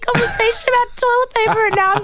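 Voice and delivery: high, fast disbelieving outburst; can't believe what she hears; incredulous. Real, not acted. Incredulous at co-host's theory.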